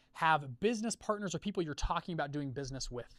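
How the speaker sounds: ordinary delivery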